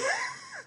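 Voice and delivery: a quick high giggle breaking out; short high laugh. Real, not acted. Telling an amused audience, a short high laugh.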